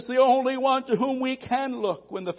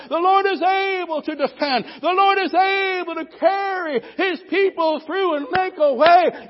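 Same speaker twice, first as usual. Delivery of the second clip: loud, rising preacher's cry, hammering "the Lord is"; full-force preaching; 4 semitones higher, 6 dB louder than usual. Acted. Preacher rallying the congregation that the Lord still reigns, shouting. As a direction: build it like a revival climax, voice cracking with fervor.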